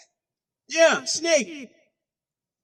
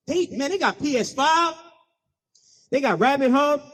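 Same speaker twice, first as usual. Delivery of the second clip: normal talking voice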